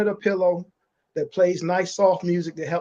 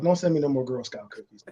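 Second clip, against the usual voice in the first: whispering; 6 semitones lower, 6 dB quieter than usual. Real, not acted. Aside, hushed plea.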